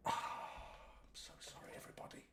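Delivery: near-whispered, breathless sorry; hushed apology. Real, not acted. Streamer apologising to viewers, almost whispered. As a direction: drop almost to a whisper, sheepish.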